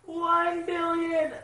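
very high, loud and very slow, stretching each word; awed exclamation. Real, not acted. Fan repeating the bounty in stretched amazement. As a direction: savour the number in disbelief.

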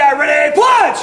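loud karate punch call; coach shouting commands. Real, not acted. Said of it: "Last time, super loud".

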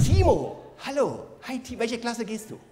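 ordinary talk, nothing marked

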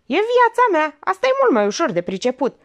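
very high, loud defiant cry; furious outburst. Acted. Olga defying her aunt, loud and high. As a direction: throw it in her face.

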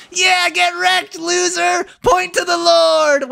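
very high, slow, half-crying yell; real triumphant yelling; victory shout. Real, not acted. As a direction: scream it at the rival like you just won the cup. Winning shootout, celebrating wildly.

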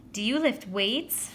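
put-on slow girlish coo; drawn-out, admiring question.

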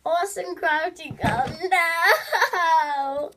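high, loud put-on voice; hammed-up name, wailing into the last word; YouTuber impression. Attempting another YouTuber's intro.

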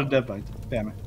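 ordinary delivery

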